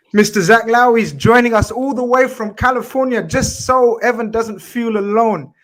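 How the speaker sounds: high, loud host introduction; announcer intro. Acted. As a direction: build it up like a ring announcer.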